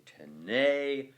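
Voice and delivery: "tene" with a loud, long "ne"; te-NE, second syllable held; stress on NE. Shown as a demonstration. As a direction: land firmly on the "ne" of "tene". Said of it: "The stress is therefore on the".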